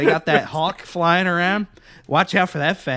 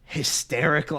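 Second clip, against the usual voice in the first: ordinary talk, nothing marked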